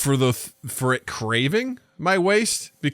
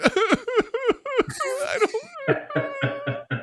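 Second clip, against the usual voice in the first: 1 laughing squeal; 4 dB quieter than usual. Pretend. Terrified toilet voice.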